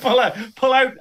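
shout. Pretend. Shouted joke echo.

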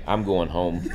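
low, subdued mock defeat; deflated. Friend giving up after the loss, deflated. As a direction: drop the voice like a beaten fan.

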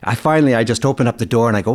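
ordinary delivery

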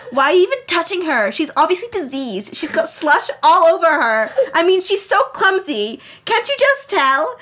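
put-on high-pitched, indignant mean girl; bratty bully. Jealous bully insulting the slushed heroine, high bratty voice. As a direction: pitch it up and climb with each insult. Bully Haley sneering in the story.

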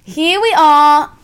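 slow-starting put-on Australian accent. Shown as a demonstration. Co-host showing off another line, in an Australian accent. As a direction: announce it in mock-Australian. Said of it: "Do your Australian".

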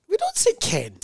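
high indignant we don't say Ken; high mock indignation. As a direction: squeal it in fake outrage.

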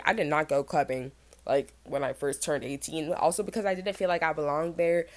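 normal talking voice

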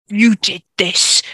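slow, loud snarled accusation. A narrator's reading. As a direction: snarl it out word by word. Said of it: "Ricky snarled".

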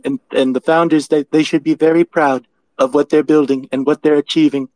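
mock presidential speech, pitched well above own voice; Donald. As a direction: impersonate a self-praising president endorsing with deliberate pauses. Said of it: "Thank you very much, Donald".

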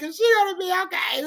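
high, fast anxious question; a hint of a panicked stranger; worried bystander. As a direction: ask in a hurry, pitched high.

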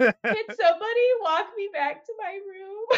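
high, whimpering scared plea; half-laughing, half-crying plea to be walked home; tearful plea. Retold or imitated. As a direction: beg like a terrified kid near tears. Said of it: "And then I was just like".

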